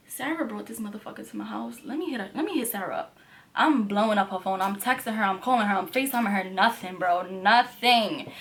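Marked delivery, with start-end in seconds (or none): 0.1-3.2 soft quick aside to herself; muttered to herself. Retold or imitated. Narrator deciding to call Sarah, muttered quickly. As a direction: mutter it, planning to text Sarah. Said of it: "So I'm like".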